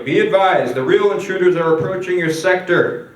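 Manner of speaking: forceful radio dispatch from command; commander on the radio. Acted. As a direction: relay an urgent warning to the troops. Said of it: "the commander's on the radio".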